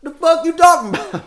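high, loud mock outrage; mock incredulous yell. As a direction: shout it like an appalled local. Mock American reply.

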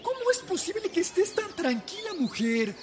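high, exasperated villager woman. Acted. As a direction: demand an answer, baffled. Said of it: "se atrevió a levantar la voz para preguntar".

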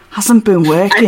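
put-on British regional accent; Hutch accent. Shown as a demonstration. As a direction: try the line in a mock British accent. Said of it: "What should Hutch's accent be".

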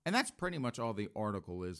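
ordinary talk

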